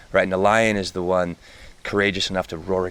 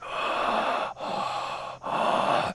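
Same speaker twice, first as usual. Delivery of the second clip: connected breathwork gasps; demonstrated breathing; 5 dB quieter than usual. Shown as a demonstration. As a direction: breathe heavily with no gaps between inhale and exhale. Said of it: "it's like".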